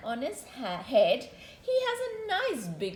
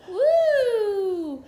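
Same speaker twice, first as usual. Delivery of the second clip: long, high, loud woo; playful whoop; 7 semitones higher, 11 dB louder than usual. Acted. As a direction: let out a drawn-out cheer. Cheering during bath play.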